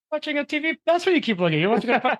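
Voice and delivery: high, laughing teasing of a distracted host; giggly mock accusation; laughing tease. Real, not acted. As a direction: laugh through the jab about the TV. Teasing host about watching TV.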